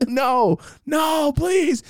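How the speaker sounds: high-pitched wail; hammed-up panicked begging; desperate pleading. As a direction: beg in a high, crying-out voice. Begging against his imagined future.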